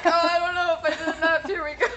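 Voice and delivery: high, loud, rapid mock worry, laughing; imitating last-ditch effort. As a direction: tease a friend with a high, hurried impression. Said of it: "that was your last ditch. You're like".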